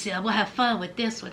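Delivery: ordinary delivery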